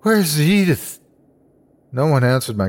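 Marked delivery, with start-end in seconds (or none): 0.0-1.0 high, pleading question; distressed question. A narrator's reading. Waking patient asks after Edith, high and distressed. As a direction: ask it higher, in distress.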